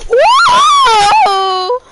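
long, high-pitched loud whoa; real shout of excitement, drawn out and high; excited scream. Real, not acted. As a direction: whoop it out at full voice, held long. Excited mid-match at a chug jug find.

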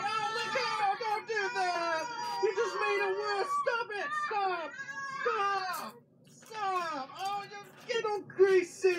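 real panicked shouts, high and loud; frantic yelling. Real, not acted. Kids smearing pizza on windshield.

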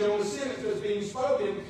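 normal talking voice

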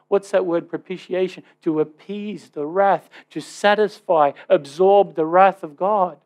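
ordinary talk, nothing marked

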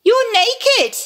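high, loud shocked cry. Acted. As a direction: shriek it in alarm. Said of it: "Eve cried".

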